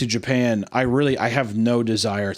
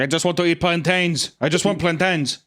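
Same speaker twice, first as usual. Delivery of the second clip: higher-pitched mock Brazilian voice; mock Brazilian accent; 7 semitones higher than usual. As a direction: plead in a put-on Brazilian accent. Voices the Brazilian character's plea.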